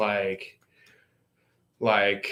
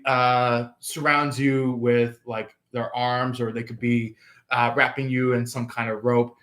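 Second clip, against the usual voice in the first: ordinary talk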